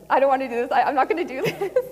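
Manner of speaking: whiny mock refusal, breaking into a laugh; reluctant protest. Woman retelling her refusal to do this, protesting.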